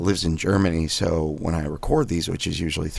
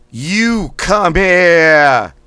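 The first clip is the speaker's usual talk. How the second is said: You come here slow, high and loud, here as Boston "hiah"; Boston accent; 10 semitones higher, 10 dB louder, much slower than usual. Shown as a demonstration. Coach leading Boston warm-up, slow loud Boston You come here. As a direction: stretch it out in Boston with a dropped R. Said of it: "It's gonna be in a Boston accent".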